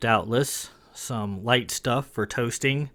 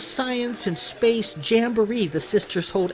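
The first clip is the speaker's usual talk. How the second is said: eager lord; 7 semitones higher than usual. Acted. Lord Lon chatters proudly.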